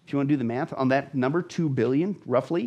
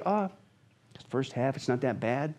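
dismissive shrug; 3 semitones lower, 6 dB quieter than usual. Retold or imitated. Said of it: "for people to sit there and say".

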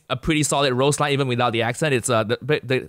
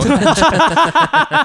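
loud laugh; 12 semitones higher, 8 dB louder than usual. Real, not acted. Laughing loudly at his own food joke. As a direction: burst of high, loud laughter.

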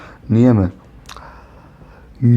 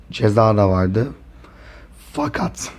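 usual speaking voice

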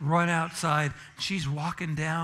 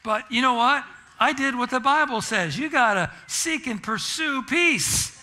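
louder, higher preaching emphasis; 8 semitones higher, 7 dB louder than usual. Acted. Pastor driving the verse home to the congregation, preaching. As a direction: drive the Bible verse home.

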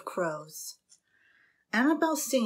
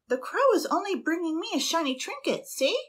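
put-on much higher, light innocent girlish voice; Annabel's voice; 10 semitones higher than usual. A narrator's reading. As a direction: sweetly excuse the crow.

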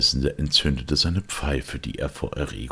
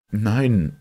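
higher, mildly dismissive no; a touch of protest, pitched up; warding off; 9 semitones higher, 7 dB louder than usual. A narrator's reading. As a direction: wave the offer away. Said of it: "wehrte er ab".

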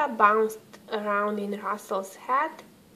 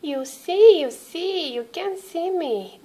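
a higher hint of playful Santa; lightly voiced Santa; 7 semitones higher than usual. A narrator's reading. Santa teasing the boy who can't see him, playful.

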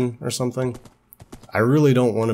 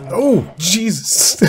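high, loud, drawn-out shock; real startled cry; 11 semitones higher, 7 dB louder, much slower than usual. Real, not acted. Player suddenly scared in the game, startled yell. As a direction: yelp as if jumped by something. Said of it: "That actually kinda scared me".

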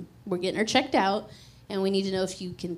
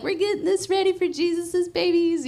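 higher, bright retold cheer; joyful; 8 semitones higher, 4 dB louder than usual. Mother sanding the nursery with her mom, joyfully. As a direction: sing out the hope of kids coming. Quoting herself while sanding walls.

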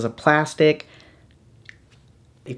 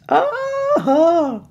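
high excited cry of joy; delighted squeal; 13 semitones higher than usual. Real, not acted.

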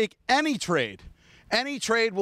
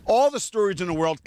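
ordinary delivery

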